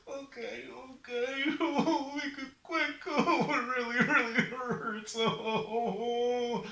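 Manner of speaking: high, drawn-out whimpering; sobbing in pain. Acted. Sufferer begs Raven to be quick, hammed-up sobbing. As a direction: moan and sob like a hammed-up patient. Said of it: "Don't cry".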